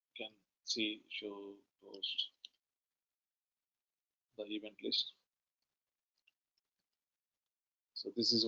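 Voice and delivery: very low volume, hard to hear; speaking far too softly to be heard; barely audible. Real, not acted. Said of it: "[name], your voice is very low".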